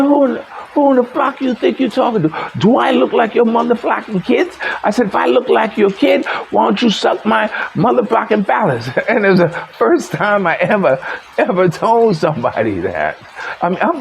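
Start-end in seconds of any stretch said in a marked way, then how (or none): 0.0-8.9 high-pitched indignant tirade, not shouted; heated retold comeback at talking volume; retold upset outburst. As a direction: re-enact telling off an opponent. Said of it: "he got me so upset that I screamed".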